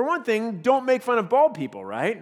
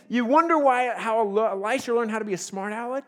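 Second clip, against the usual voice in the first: ordinary talk, nothing marked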